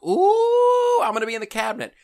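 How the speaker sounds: high excited squeal. Retold or imitated. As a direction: squeal it like a giddy girl. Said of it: "She's like".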